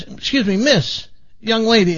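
lightly acted priest hailing a woman; calling after someone. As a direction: call politely after someone walking away. Priest calls after departing woman.

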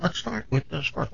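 very soft, hushed echo of oneself; echo on the line. Retold or imitated. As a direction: repeat it faintly, like a phone echo. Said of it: "an echo saying".

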